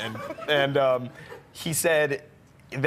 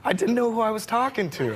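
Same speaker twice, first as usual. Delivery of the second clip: lightly voiced Michael, higher; 7 semitones higher, slower than usual. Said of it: "he goes".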